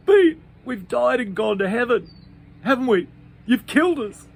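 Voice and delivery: high, loud frightened wail; panicked cry. Acted. Pete wailing at Bean in panic, high and loud. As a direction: cry out sure you're dead. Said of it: "And Pete cried out".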